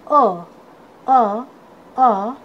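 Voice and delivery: अ as a lone short "uh", repeated; phonic letter sound. Shown as a demonstration. Teacher showing students the sound of U, phonic letter sound. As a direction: sound out अ as U starts "umbrella". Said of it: "यू का sound होता है".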